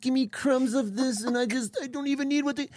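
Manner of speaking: desperate pleading. Retold or imitated. Acting out his desperate plea.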